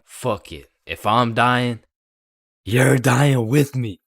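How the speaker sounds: loud, slow furious vow; angry vow. Retold or imitated. Out of sheer anger.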